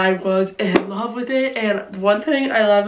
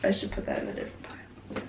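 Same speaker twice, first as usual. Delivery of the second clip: whispered aside; 13 dB quieter, faster than usual. Real, not acted. Aside while sorting books.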